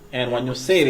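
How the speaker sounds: usual speaking voice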